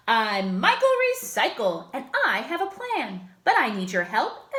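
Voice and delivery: rally the town like a cheerful superhero. Acted. Hero rallying the townsfolk, booming and bold.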